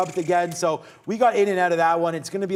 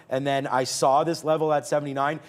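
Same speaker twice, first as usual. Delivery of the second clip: ordinary delivery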